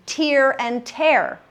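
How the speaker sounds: slow contrast, first tear rhymes with ear, second tear with air; two pronunciations. Shown as a demonstration. Teacher shows students heteronyms, two pronunciations of tear. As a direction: TEAR as teer, then TEAR as tair, slowly. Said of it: "just pronounced differently".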